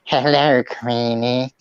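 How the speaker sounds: low, soft, creepy drawn-out greeting; Peter Lorre. Acted. Friend greets Queenie as a film villain, Peter Lorre impression. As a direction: croon it low like a sinister henchman. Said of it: "That's a good Peter Lorre".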